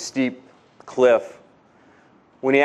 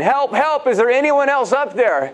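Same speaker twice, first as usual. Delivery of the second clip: much higher, frantic call for help; yelling again for help; 8 semitones higher than usual. Retold or imitated. Jack refuses God's answer and yells for someone else, high and frantic. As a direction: call out in high-pitched panic. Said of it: "began to yell again".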